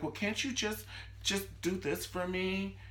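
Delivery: high, softish put-on wheedling; mock girlish pleading. Girl to Jeffrey after he comes out, mock girlish pleading. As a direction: wheedle like an imitated clueless girlfriend.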